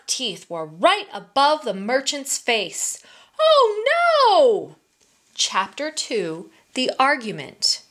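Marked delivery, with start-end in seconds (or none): 3.4-4.8 high, loud wail of dismay; alarmed cry. Acted. Merchant pinned under the tiger's teeth, alarmed cry. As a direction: cry out in sudden horror.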